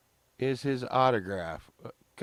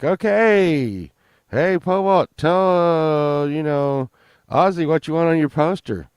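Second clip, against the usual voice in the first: loud, higher hype-man call; excited bartender; 6 semitones higher, 9 dB louder than usual. Retold or imitated. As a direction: announce it to the bar like a big win. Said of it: "and they're like".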